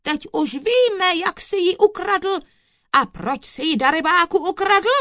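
high, loud interrogation; blustering judge at full voice; shouting. A narrator's reading. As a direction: hammer the accused with shouts. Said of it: "křikl soudce na kouzelníka".

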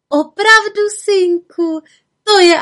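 high, loud giggly voice; giggling. Acted. Witch giggling at the bewitched forester. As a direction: tease him with a giggle. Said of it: "Čarodějnice se zahyhňala".

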